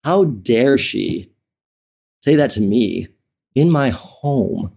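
slow, measured retold outrage; simmering offence, spaced out with pauses; indignant anger. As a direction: draw out each phrase in restrained indignation. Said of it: "And then I got angry".

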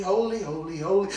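usual speaking voice

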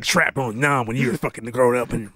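low, fast veteran partner; Gary Busey. Retold or imitated. Bragging about Vietnam, Gary Busey impression. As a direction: rattle it off low like an old-hand cop. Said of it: "I should just do the Gary Busey lines".

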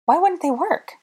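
very high disbelieving question; indignant. A narrator's reading. Hermione questioning Draco about wands, indignant. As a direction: demand it in high alarm.

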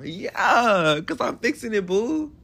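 high playful sass; sassy teasing. Acted. Teasing his friend, he answers high and sassy. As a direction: toss it off sassy to your pookie.

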